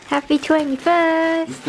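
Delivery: loud, bright birthday cheer; excited cheer. Real, not acted. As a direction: cheer it out, slowly.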